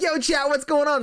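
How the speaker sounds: high-pitched mock streamer hail; retold call to chat. Streamer hailing his chat during lag, high-pitched retold call.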